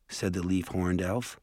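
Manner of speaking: usual speaking voice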